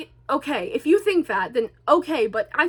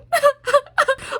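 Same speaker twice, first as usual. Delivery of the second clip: loud, high burst of giggles; high hysterical laugh; 13 semitones higher, 4 dB louder than usual. Real, not acted. Vlogger recalling parents' dirty texts, high hysterical laughter. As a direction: crack up helplessly at a high pitch.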